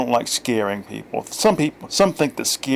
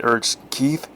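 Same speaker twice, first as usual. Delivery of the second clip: ordinary delivery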